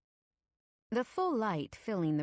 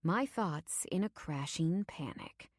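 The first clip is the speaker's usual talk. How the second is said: ordinary delivery, nothing marked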